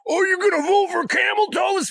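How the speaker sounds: high mocking shout of a fool; high, loud mock voice of a voter. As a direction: squeal it out to mock them. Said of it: "motherfuckers like".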